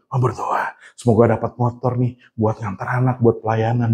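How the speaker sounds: low, soft murmured prayer, imitated; praying wife. Wife asks God for the motorbike, hushed imitated prayer. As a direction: pray softly and low, as his wife would. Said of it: "Istri saya berdoa".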